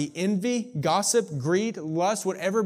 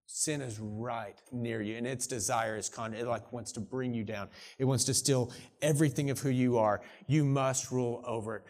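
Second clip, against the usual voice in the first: soft, low and close, like leaning in to warn; close, gentle warning; 4 semitones lower, 4 dB quieter than usual. Acted. God leaning close to warn Cain, softly tender. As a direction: hush it down, a tender caution. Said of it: "and he leans close".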